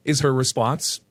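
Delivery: ordinary delivery, nothing marked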